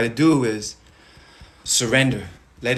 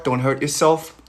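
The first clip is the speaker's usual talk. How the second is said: normal talking voice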